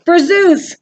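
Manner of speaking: loud, high rallying shout; fierce acted war cry; battle cry. A narrator's reading. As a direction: shout it as you charge into combat. Warrior's cry as she charges.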